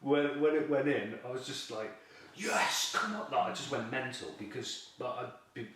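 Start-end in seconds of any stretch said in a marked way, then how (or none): 2.4-3.3 high, loud cheer of victory; triumphant yell. Retold or imitated. As the fish goes into the net, a high loud cheer. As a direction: roar it as the net closes.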